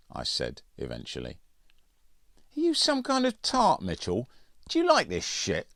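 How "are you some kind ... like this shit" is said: high, put-on mocking schoolboy voice; sneering bully voice. Andrews mocking Mitchell about the camping trip, high jeering voice. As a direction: jeer at him in a high mocking voice.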